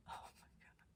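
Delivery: barely audible amazement; awed whisper. Real, not acted. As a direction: breathe it out in stunned wonder. Awed by birds never seen before.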